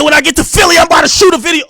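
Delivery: high, loud hammed-up threat; mocking shout. Host mocking the rapper's threat to visit, hammed-up high shout.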